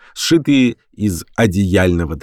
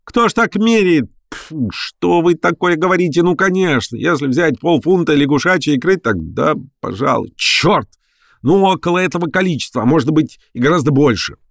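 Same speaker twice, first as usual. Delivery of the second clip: high, exasperated professor; flustered outrage; 8 semitones higher than usual. A narrator's reading. Professor correcting an ignorant reporter, flustered and outraged. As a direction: sputter indignantly at a high pitch. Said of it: "Персиков побагровел".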